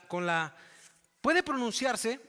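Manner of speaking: ordinary talk, nothing marked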